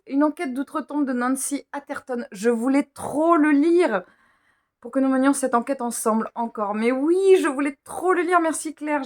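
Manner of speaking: high, gushing delight; real excitement. Real, not acted. Reader thanking her friend for the book, thrilled. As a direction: gush loudly over a longed-for gift.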